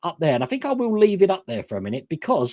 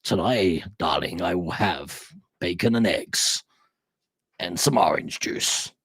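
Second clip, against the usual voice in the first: much lower, slow movie-trailer narrator; deep trailer voice; 11 semitones lower, slower than usual. Retold or imitated. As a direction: talk to your wife like an epic trailer. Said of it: "how he must talk to his wife".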